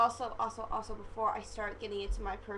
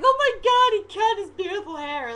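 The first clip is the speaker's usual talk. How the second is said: much higher and louder, hammed-up despair; mock fan wail; 10 semitones higher, 12 dB louder than usual. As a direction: wail like a heartbroken fangirl. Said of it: "Everyone else in the free world is like".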